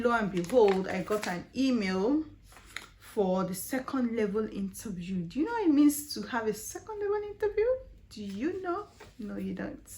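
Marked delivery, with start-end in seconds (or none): 5.2-8.9 high, fast, teasing rhetorical question; excited question. Real, not acted. Vlogger quizzes viewers about the second interview, excited. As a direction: tease the viewers with it.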